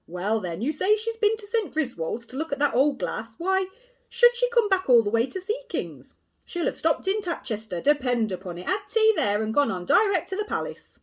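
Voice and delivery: loud, hearty, patronising policeman; a self-satisfied constable soothing a boy; pompous inspector. A narrator's reading. Inspector reassuring Kay with pompous bluster.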